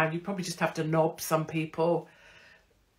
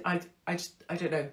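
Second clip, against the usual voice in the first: ordinary talk, nothing marked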